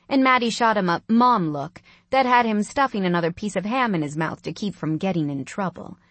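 ordinary delivery, nothing marked

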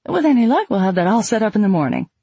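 bright, eager welcoming secretary; sweet-talking Southern hostess; chirpy hostess. A narrator's reading. Hostess answers housing question.

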